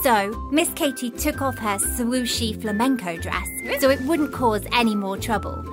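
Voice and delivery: ordinary delivery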